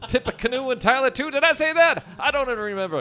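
high, loud, laughing tipsy president; tipsy Roosevelt. Acted. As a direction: giggle through like a tipsy old statesman. Drunk Roosevelt character bit.

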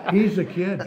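laughing through the words; chuckling while saying it. Real, not acted. Laughing at young man joke.

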